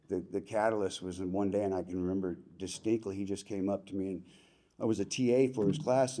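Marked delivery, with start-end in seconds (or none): none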